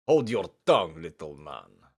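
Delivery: low, loud Russian threat; menacing Russian accent. Acted. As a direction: growl it like a hulking teacher. Misha threatening.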